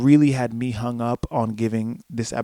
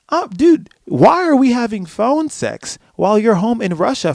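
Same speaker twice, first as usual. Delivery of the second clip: high, louder exasperated rant; mock scolding; 7 semitones higher, 4 dB louder than usual. Viewer to closeted hockey player on the phone in Russia, exasperated. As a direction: scold them in hammed-up disbelief. Scolds characters in acted speech.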